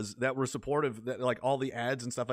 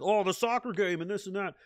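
mocking sports fan; 5 semitones higher than usual. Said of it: "But yeah, like".

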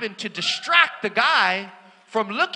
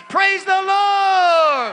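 much higher, louder, slow drawn-out shout; long loud triumphant Praise the Lord; scream of praise; 9 semitones higher, 8 dB louder, much slower than usual. Retold or imitated. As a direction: let the victory burst out. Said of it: "I start to scream inside of me".

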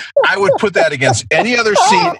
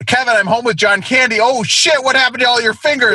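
high mock parent, cheery turning to shock; hammed-up parent finding the fingers gone; spoof movie parent; 8 semitones higher than usual. Spoofing Home Alone, the parent finds Kevin's fingers missing, high mock shock.

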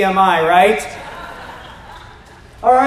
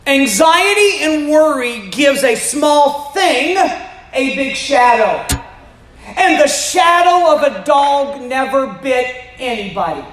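raised, slow, emphatic sermon voice; preaching; 4 semitones higher, slower than usual. Acted. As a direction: drive it home to the congregation. Preaching to the congregation.

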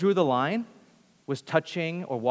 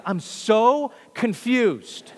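drawn-out, higher, baffled; bewildered; 6 semitones higher, 4 dB louder, much slower than usual. Retold or imitated. Peter baffled by Jesus washing feet: slow, higher bewilderment. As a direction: stretch it out slowly, lost. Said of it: "Peter's like".

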